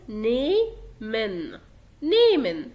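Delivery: nehmen with the H left unpronounced, slow then repeated; silent H. Shown as a demonstration. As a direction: say "nehmen" with no H sound at all. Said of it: "ما بقرأش الها أصلًا". (نطق "nehmen" من غير حرف الها خالص)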